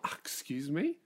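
soft, rising indignant query; mock offended. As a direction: play affronted disbelief, quietly. Fire acted as taking offence.

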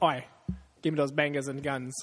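mock thick British Cockney accent. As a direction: ham up a London street British accent. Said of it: "thick-ass British accent".

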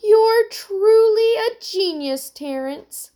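put-on high, gushing girl's voice; giddy with relief. A narrator's reading. Emily to Terrence as they are rescued, giddy. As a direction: gush with relieved delight. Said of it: "feeling giddy with relief".

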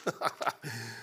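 short, soft chuckle; amused laugh. Real, not acted. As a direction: laugh low at your own remark. Amused by the too-crazy book.

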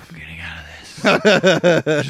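slow, drawn-out grim drawl; mock brooding actor, dragging it out; Matthew McConaughey. Finishing the mood-killing speech to the party, slow drawl. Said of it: "Matthew McConaughey shows up. He's like".

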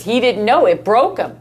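ordinary talk

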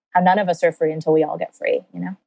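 ordinary talk, nothing marked

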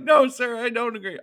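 mock recruit call-back; recruit's reply. Recruit answers sergeant's challenge.

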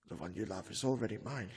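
low, quick, hushed menace; quoting Dracula. Retold or imitated. Van Helsing quietly recites Dracula's threat. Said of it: "he said".

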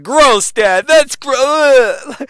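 put-on high, loud teen groan of disgust; loud, high mock daughter going gross. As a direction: squeal it out like a grossed-out teenage daughter. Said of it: "my daughter's like".

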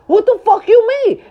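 exasperated outburst. Real, not acted. Ranting at critics.

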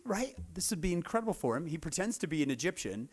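ordinary delivery

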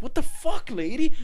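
high, loud, stunned shout at the passenger; shocked yell. Retold or imitated. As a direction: recoil and yell at her. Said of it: "And he's like".

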